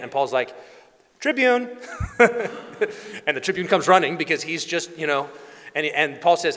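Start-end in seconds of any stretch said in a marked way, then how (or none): 1.2-1.8 high call across the room; Paul summoning the tribune, much higher. Acted. Paul calls the tribune over. 1.8-3.2 breathy chuckle at own story; amused laugh. Real, not acted. Laugh after calling out.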